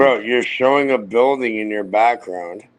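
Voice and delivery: slow, careful enunciation; slowly and clearly. Shown as a demonstration. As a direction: spell it out slowly. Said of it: "I'll say it again slowly and clearly".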